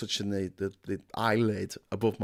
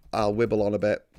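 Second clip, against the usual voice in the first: ordinary delivery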